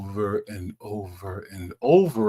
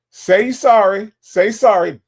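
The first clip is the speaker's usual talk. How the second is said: loud, higher, drawn-out demand; mock demand; 8 semitones higher, 9 dB louder than usual. Hamming up a chatter's demand for an apology, loud and playful. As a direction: belt it like an over-the-top demand.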